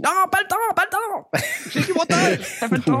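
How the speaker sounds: high hammed-up brush-off; husband waves off his wife in a high voice; busy me. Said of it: "Je dis".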